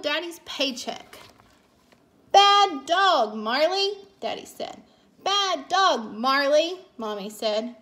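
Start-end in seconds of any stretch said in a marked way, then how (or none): 2.3-4.0 loud, high storybook scolding; emphatic, raised-voice reprimand. A narrator's reading. Daddy scolds the dog. 5.2-6.9 raised, louder telling-off; loud, emphatic reprimand; scolding. A narrator's reading. Mommy scolds the dog.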